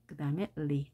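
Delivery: ordinary talk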